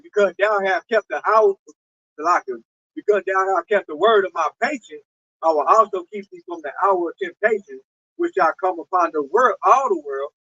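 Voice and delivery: lifted King James recitation; scripture reading. Real, not acted. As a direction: read the verse out like from the pulpit. Reads scripture aloud.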